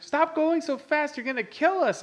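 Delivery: high frantic shout to slow down; screaming at the drunk driver to stop; panicked yell. Retold or imitated. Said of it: "I was like".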